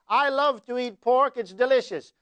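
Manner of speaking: ordinary talk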